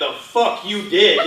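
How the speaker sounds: high, loud, slow indignant comeback; mock polite retort. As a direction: deny it emphatically, laughter breaking in. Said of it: "he politely went".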